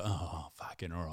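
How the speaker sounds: quiet, grudging reply; grudging mumble. Retold or imitated. Opponent mumbling grudging acceptance after an apology. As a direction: mumble it like a beaten bloke.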